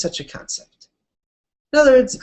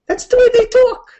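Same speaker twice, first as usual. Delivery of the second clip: much higher and louder, thrown out; emphatic mock shout; 6 dB louder than usual. Shown as a demonstration. Teacher repeating the line, high and loud. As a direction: belt it like a shrugging exclamation. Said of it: "that that's the way they talk".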